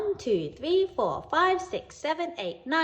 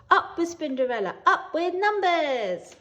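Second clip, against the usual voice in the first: a laughing cheer rising higher; 8 semitones higher, 4 dB louder than usual. A narrator's reading. Spiders lifting Spinderella after her winning goal, laughing cheer. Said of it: "all together they cheered".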